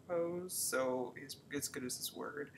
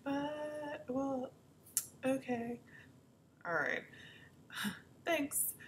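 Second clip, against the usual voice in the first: mock hesitant ex; 10 semitones higher, much slower than usual. Ex hesitantly answering her.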